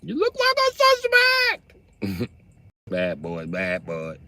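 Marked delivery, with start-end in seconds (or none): 0.0-2.3 mock cop accusation. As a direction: accuse them like a hyped cop.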